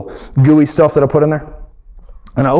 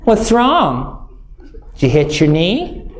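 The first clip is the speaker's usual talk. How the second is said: higher, doting voice, lightly put on; fussing wife; 6 semitones higher than usual. Wife Whitney fussing over her hurt husband, lightly mocking doting voice. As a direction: coo over him like a worried wife. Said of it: "She goes".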